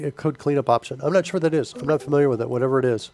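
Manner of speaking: normal talking voice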